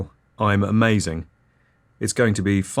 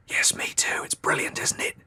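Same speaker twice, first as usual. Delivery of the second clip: delighted hushed whisper; 5 dB quieter than usual. Acted. Wife whispering to husband, thrilled whisper. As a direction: whisper back, thrilled despite the smell. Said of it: "she says".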